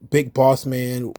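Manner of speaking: normal talking voice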